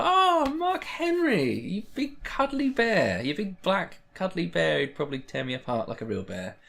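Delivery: a slow swooning falsetto, hammed up; mock swooning fan. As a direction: coo over him like a lovestruck fan. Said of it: "And it's just like".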